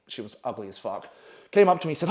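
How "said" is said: plain reading voice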